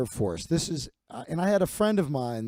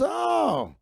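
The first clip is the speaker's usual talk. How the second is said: uncle's mock exclamation; 11 semitones higher than usual. Uncle spotting nephew's dress hat, high teasing hoot.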